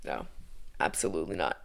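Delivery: low, whispered dismissal; hushed, under-the-breath no; whispered refusal. Real, not acted. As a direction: whisper it, shutting the idea down. Rejects her own mock cheer.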